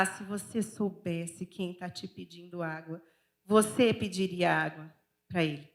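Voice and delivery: low, slow, soft tenderness; loving, full of grace. Acted. Jesus answering the Samaritan woman, loving and gentle. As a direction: speak gently down to her with love. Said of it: "com o seu olhar de amor".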